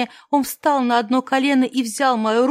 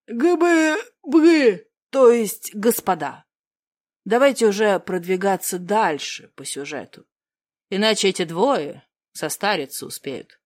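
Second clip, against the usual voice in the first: голос Монти; 4 semitones lower than usual. Acted. Монти Агафон вмешивается.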